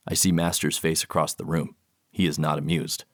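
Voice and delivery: plain reading voice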